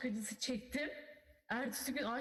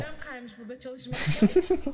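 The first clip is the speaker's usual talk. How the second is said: loud complaint; 13 dB louder than usual. Pretend.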